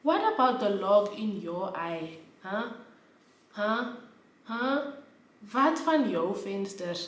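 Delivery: slow, needling "Huh? Huh?" challenge; pointed challenge. Retold or imitated. Throwing the log-in-your-eye line back at the man, slow and needling. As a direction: press him, rising each time. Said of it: "I really was like".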